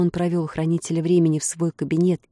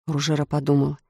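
plain reading voice

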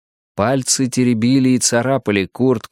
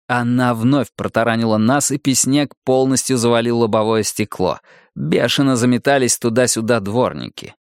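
ordinary delivery, nothing marked

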